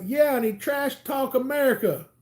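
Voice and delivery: high, mock-outraged report; indignant buddy. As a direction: tell on him, indignant. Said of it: "he's like".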